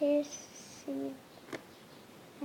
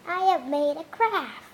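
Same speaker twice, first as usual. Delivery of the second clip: ordinary delivery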